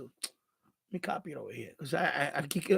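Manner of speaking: ordinary delivery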